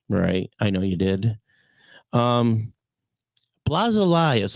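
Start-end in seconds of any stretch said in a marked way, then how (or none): 3.7-4.6 Elias said "ee-LIE-us"; long I in Elias. Shown as a demonstration. Asking [name] about drummer's name, [name] as ee-LIE-us.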